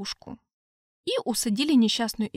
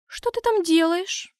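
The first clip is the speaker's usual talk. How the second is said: higher, slow suspicious question; 7 semitones higher, slower than usual. Acted. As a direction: ask warily, eyes narrowed. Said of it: "подозрительно обернулась Аурелиэль".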